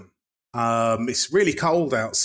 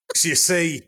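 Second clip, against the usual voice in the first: put-on Cockney accent; 5 semitones higher than usual. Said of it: "who I'm now gonna be".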